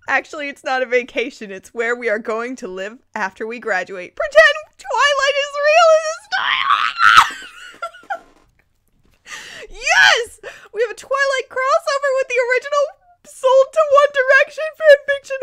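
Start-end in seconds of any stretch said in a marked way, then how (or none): 4.1-15.4 very loud, high fangirl scream; excited screaming. Real, not acted. Reader screaming at the Twilight twist, overjoyed shriek. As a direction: shriek it like a fan who can't believe it.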